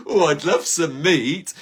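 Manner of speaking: milk the craving, drawing out "love"; hammed-up craving. Shown as a demonstration. Shopper at the farm shop hamming up a meat craving. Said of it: "repeated, drawn out".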